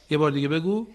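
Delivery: ordinary delivery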